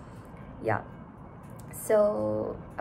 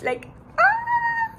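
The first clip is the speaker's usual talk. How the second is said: excited squeal; 8 dB louder than usual. Real, not acted. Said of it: "I can't shout because".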